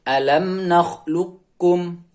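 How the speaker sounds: "nakhluqkum" recited slowly, qaf held without echo into kaf. Shown as a demonstration. Teacher shows students how qaf meets kaf, recited slowly without qalqalah. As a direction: draw out "nakhluqkum", no bounce on the q. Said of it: "cara membacanya".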